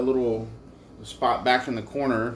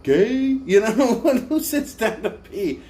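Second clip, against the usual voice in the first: mock shocked; 9 semitones higher, 4 dB louder, slower than usual. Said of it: "And I'm like".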